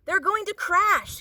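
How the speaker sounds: high, alarmed gasp. A narrator's reading. Stellaluna gasping as her bird friends fall. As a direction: gasp out the danger. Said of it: "gasped, gasped Stellaluna".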